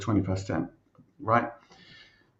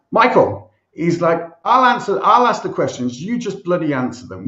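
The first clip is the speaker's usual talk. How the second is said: raised, higher irate voice, cutting someone off; louder, heated telling-off in retelling; furious interviewer; 4 semitones higher, 5 dB louder than usual. Retold or imitated. Irate interviewer telling candidate he asks the questions, angry. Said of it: "he's going fucking bananas".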